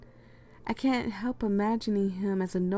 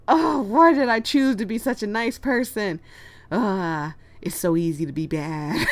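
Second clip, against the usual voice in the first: hammed-up groaning regret, starting loud; mock inner lament; 7 dB louder than usual. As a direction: burst out in playful exasperation, then settle. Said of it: "all I'm thinking is like".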